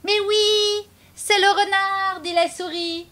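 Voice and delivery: high, loud sing-song greeting; cheeky mouse calling. Acted. Mouse hailing the fox, high and loud. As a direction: shout hello to the fox.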